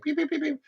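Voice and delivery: high-pitched squeaky chicken beeps; rapid string of high, beeping clucks; chicken imitation. Man mimics the chickens the factory women work around, squeaky chicken noises. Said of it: "being around chickens all day".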